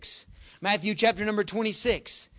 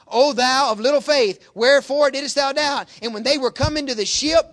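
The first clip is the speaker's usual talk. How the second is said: loud, raised scripture proclamation; full-force preaching; 5 semitones higher, 9 dB louder than usual. Acted. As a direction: declaim the rebuke from the pulpit.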